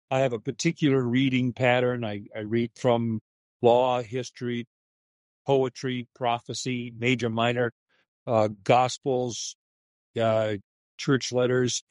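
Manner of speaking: ordinary delivery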